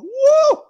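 loud, high-pitched "woo"; high whoop. Acted. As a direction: let out a heated-up whoop. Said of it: "I'm like".

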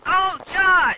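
high, loud cry to a teammate; alarmed shout. Real, not acted. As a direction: yell out in sudden alarm. Startled call to partner in game.

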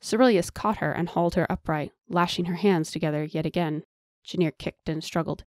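ordinary delivery, nothing marked

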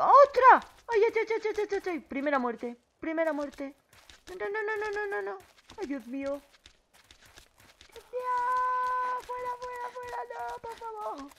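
panicked high shrieks; frantic cries while fleeing; being chased. Real, not acted. Chased by feral zombie.

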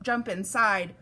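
ordinary delivery

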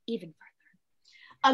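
slow, breathless whispered afterthought; whispered aside. Real, not acted. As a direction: let it fade to a whisper under your breath. Willow muttering to herself.

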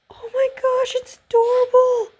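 very high breathless squeal; gasp, then squeak it out; real cute-overload squeal; squeal of delight. Real, not acted.

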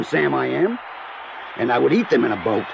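ordinary delivery

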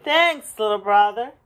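grateful dump truck. A narrator's reading. Dump truck thanking little Blue, hearty gratitude. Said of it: "said the dump to Blue".

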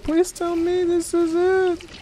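very high, drawn-out please; high, slow pleading. Real, not acted. Repeated plea, high and slow.

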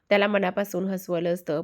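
ordinary delivery

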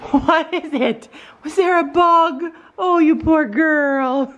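high, slow pet talk. Real, not acted. Owner cooing to her scared deaf dog.

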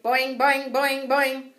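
loud, high springy boings; ping the boings wildly; upset twang. A narrator's reading. Frog splashing in distress, loud springy boings. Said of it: "Og splashed wildly".

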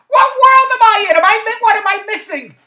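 loud, high cry of disbelief; exasperated high outcry. Real, not acted. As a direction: throw it up to the heavens in despair. Ranting in disbelief.

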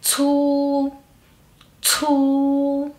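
"chu" as puffed ts plus long u; aspirated CU. Shown as a demonstration. Teacher modelling C U, "chu" said as exhaled tsu. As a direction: draw out "chu" level after the puff. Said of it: "Que sería".

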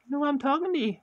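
put-on very high, loud baby-talk to the cat; high pet-talk voice. Owner cooing very high at his cat. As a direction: coo up high to get his attention.

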